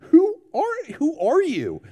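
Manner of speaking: high, incredulous who are you; stunned disbelief at the man; astonished question. Retold or imitated. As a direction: gasp it out in wonder. Quoting own awe at Roger.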